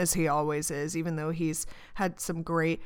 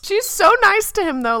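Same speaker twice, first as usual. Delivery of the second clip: much higher and louder outburst; indignant; 12 semitones higher, 11 dB louder than usual. Real, not acted. Podcaster indignant about Peggy's kindness, loud outburst. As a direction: burst out in disbelief. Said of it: "indignant reaction".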